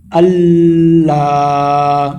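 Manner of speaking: slow, drawn-out tajweed chant; slow chanted Arabic recitation; Quranic recitation. Shown as a demonstration. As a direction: recite it melodically and unhurried. Recites word after spelling.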